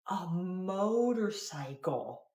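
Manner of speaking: slow, drawn-out realization. Real, not acted. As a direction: stretch it out as it dawns on you. Realizing what toddler means.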